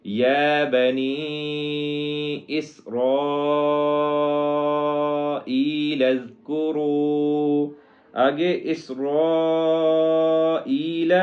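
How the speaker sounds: یا بنی اسرائیل chanted very slowly, vowels held long; long madd. Shown as a demonstration. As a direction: stretch the مد in اسرائیل long and melodic. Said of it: "اس کو ایک سے تین گنا لمبا کریں گے". (مد کو لمبا کھینچ کر تلاوت)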